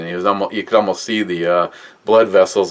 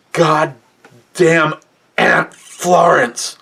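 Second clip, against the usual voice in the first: put-on much higher, slow strained angry old man; angry grandpa; 8 semitones higher, much slower than usual. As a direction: spit out the aunt's name in fury. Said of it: "looks at me, he's like".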